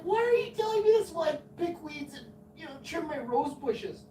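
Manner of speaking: retold exasperated bewilderment; flustered protest. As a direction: protest in baffled disbelief at the overshare. Retells flustered reply to neighbour.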